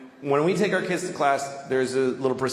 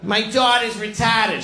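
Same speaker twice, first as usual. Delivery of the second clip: teacher voice; 8 semitones higher, 4 dB louder than usual. Retold or imitated. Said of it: "She used to tell us every day".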